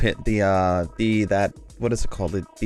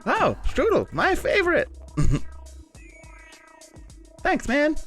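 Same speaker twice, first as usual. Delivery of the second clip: much higher pitched hero voice; high character voice. Acted. Kalo thanking for strudel.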